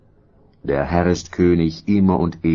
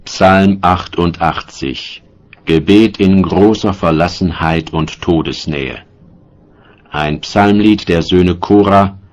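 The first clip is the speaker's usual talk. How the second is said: louder, announcing the psalm title; heading announcement; 5 dB louder than usual. Acted. Reader announcing the next psalm heading, louder. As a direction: set the heading apart like a title card.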